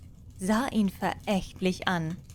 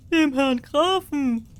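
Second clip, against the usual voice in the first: loud, higher, slow chorus shout; peasants calling out; 6 semitones higher, 6 dB louder than usual. Acted. Peasants call out to the king, loud and slow. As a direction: call it out together as the crowd.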